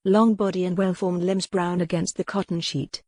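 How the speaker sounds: usual speaking voice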